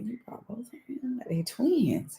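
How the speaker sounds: whispered, retold aside; secretive stage whisper; hushed disbelief. Retells a hushed reaction to friend.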